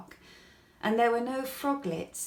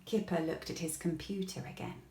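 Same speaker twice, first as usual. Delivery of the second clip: ordinary delivery, nothing marked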